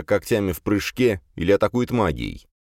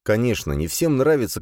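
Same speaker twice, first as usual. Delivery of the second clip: plain reading voice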